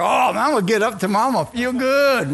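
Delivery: high, rushing retold enthusiasm; hopeful excitement. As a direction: build upward into eager, confident anticipation. Acts out his hopeful expectation.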